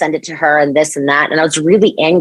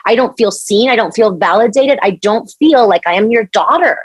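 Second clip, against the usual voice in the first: pleading; 6 semitones higher than usual. Retold or imitated. Telling mom she feels unfair.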